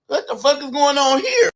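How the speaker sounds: high-pitched incredulous question; mock bewilderment. Newly met sister staring at her, mock bewilderment. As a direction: act out a baffled "what is this".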